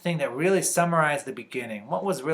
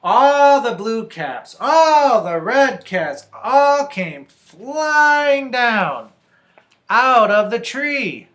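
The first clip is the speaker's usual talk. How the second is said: slow, loud, raised and stretched; climactic story narration; 9 semitones higher, 13 dB louder, much slower than usual. A narrator's reading. Reader tells children caps came flying, slow loud narration. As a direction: draw out the words as the caps fly.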